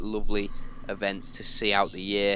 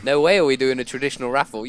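excited; 9 dB louder, faster than usual. Real, not acted. Teasing the raffle plans.

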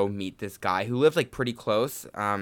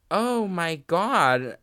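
slow, higher stunned gasp; shocked; 6 semitones higher, 3 dB louder, much slower than usual. Retold or imitated. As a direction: drag it out in disbelief. Retold shocked reaction at dinner.

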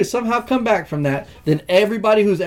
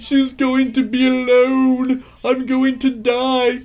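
mock dying villain; 7 semitones higher, slower than usual. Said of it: "He even said".

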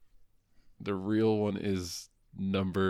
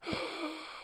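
gasp; 10 dB quieter than usual. Real, not acted. Mock gasp at good news.

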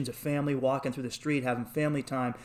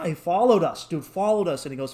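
ordinary delivery

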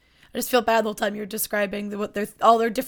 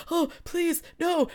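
high pleading whimper; mock begging; 7 semitones higher, 3 dB quieter, slower than usual. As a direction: beg like a cartoon villain. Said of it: "basically going".

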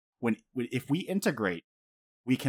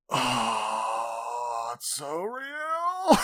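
mock crying; much slower than usual. Blubbering fan reaction to a sad scene.

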